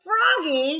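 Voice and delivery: high, loud yell of Froggy; yelled name. Acted. Frogolina yelling to Froggy across the field, high and loud. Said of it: "yelled Frogolina".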